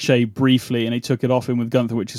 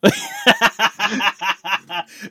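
laughing; 8 semitones higher than usual. Real, not acted. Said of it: "Sound like Dusty Rhodes".

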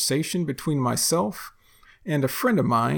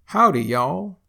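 "Howdy, y'all" in a lively Texan lilt, higher and louder; Texan greeting; 4 semitones higher, 5 dB louder than usual. Shown as a demonstration. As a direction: call out a Texan hello. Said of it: "you might hear this used in a sentence".

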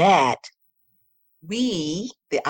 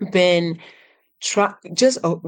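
ordinary talk

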